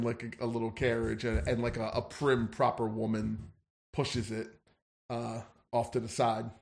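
ordinary talk

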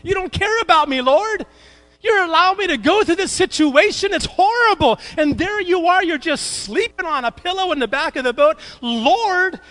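high, loud, anguished complaint to the Lord; desperate pleading. Retold or imitated. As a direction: wail at a sleeping Jesus. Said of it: "it says in one of the Gospels".

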